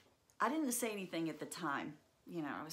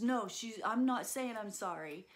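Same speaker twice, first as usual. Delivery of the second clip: louder, higher mock stubborn kid; angry boy refusing; 5 semitones higher, 5 dB louder than usual. Brother refusing to apologize to sister, louder mock-angry protest. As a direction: dig in like a cross little brother. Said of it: "so frustrated and so angry".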